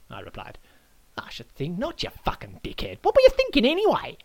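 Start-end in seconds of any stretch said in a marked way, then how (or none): 1.2-4.2 cool start, then exploding into a high shouted question; sneer, then blow up; angry Aussie insult. Acted. Adam swears at the guest, building to a shout.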